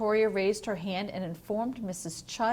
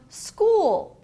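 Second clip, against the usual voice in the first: high, loud eager call; a happy kid shouting school; excited cheer; 11 semitones higher, 8 dB louder than usual. A narrator's reading. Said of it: "She ran all the way".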